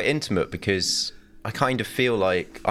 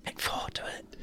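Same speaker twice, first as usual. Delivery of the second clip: whispered, much softer urging; sneaky whisper; 13 dB quieter than usual. Real, not acted. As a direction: hiss the tip conspiratorially. Sneaky aside urging a co-host.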